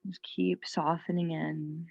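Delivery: calm yoga teacher with long, restful pauses; guided meditation. Real, not acted. Yoga teacher calming students in child's pose, guided meditation. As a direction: ease them into rest, unhurried.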